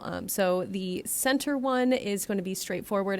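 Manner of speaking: ordinary delivery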